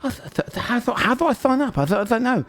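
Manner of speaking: flustered eager stammer asking to join; Jalixa stammering. Acted. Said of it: "you hear Jalixa be like".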